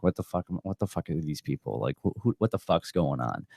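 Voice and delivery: muttered alarm. Retold or imitated. Man retelling his shock at two cops, muttering. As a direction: mutter it under your breath in shock. Retold inner alarm, voiced low.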